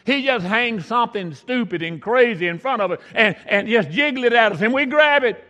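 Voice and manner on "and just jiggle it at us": normal talking voice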